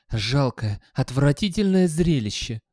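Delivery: disdainful emphasis; contemptuous. A narrator's reading. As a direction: spit it out with disgust. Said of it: "презрительно повторил Гаспод".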